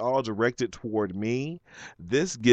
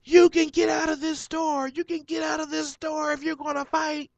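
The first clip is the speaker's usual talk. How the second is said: high, shrill mock shout at the customer; mock yelling worker. Worker ordering him out of the store, mock shout. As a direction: ham up the worker throwing him out. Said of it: "she starts yelling at me".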